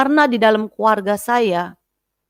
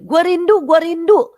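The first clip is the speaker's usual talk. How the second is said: repeated high-pitched yelling; high, loud wail of longing; retold scream; 12 semitones higher, 4 dB louder than usual. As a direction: imitate her crying out, high and insistent. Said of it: "katanya dia teriak-teriak begitu".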